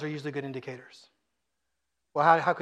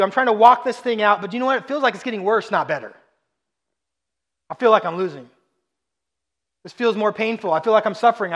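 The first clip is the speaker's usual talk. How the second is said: higher, louder, straining complaint; pleading frustration; 4 semitones higher, 7 dB louder than usual. Acted. A believer venting to the pastor, voice strained and rising. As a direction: let the frustration climb as you plead. Voicing a struggling listener.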